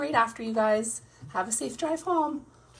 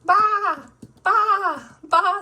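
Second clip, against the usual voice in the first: put-on high, loud child's baa; little boy bleating like a lamb; 8 semitones higher, 10 dB louder, much slower than usual. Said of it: "says George".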